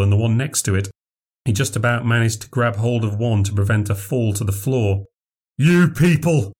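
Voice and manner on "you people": howled. A narrator's reading. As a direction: howl it down at the carpet. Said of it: "he howled to the carpet".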